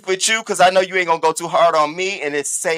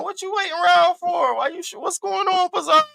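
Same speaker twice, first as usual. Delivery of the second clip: high teasing call-out; 12 semitones higher than usual. Real, not acted. Calling out Pizarro.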